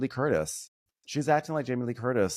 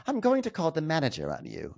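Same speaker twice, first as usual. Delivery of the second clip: fake British Karen accent; 6 semitones higher than usual. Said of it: "a Karen with a fake accent".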